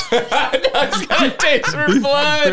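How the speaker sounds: high, slow, laughing; laughing mock-menace echo; mock ominous echo. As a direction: repeat it like a horror trailer. Echoing friend's line dramatically.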